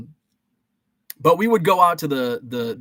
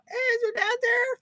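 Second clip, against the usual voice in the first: very high-pitched mock aunt voice; hammed-up falsetto caller; caricature aunt. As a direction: put on a silly, squeaky auntie on the phone. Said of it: "That's not how my aunt talked".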